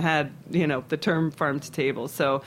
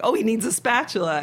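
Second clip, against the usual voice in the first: dawning realisation; 6 semitones higher than usual. Retold or imitated. Sudden realisation in the story.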